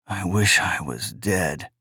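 very soft, low murmur to himself; quiet despair. Acted. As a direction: barely voice it, slow and hopeless. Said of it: "he said to himself".

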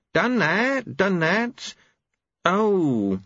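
angel voice. A narrator's reading. Angel scanning text.

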